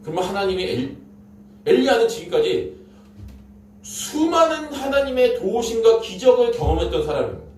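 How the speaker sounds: ordinary talk, nothing marked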